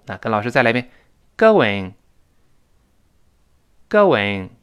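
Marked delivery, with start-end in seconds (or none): 1.4-4.5 "going" as "go-wing", falling on the second syllable; smooth w glide. Shown as a demonstration. Teacher leads repetition for students, going with w glide. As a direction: model "going" with a rounded w joining it. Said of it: "这么读确实舒服".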